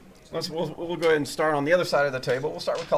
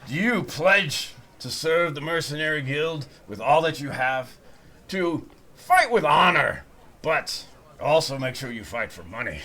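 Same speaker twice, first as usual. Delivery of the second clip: half-orc recruiter oath; 3 semitones higher, 6 dB louder than usual. Acted. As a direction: swear in a recruit with hammed-up gravity. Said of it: "he's like".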